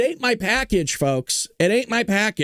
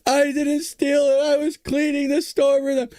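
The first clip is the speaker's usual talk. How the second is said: put-on high, drawn-out childish pleading; mock bawling; 7 semitones higher, slower than usual. Kid pleading with mom not to make him confess, mock bawling. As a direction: imitate a bawling kid begging, high and slow. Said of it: "I started bawling my eyes out".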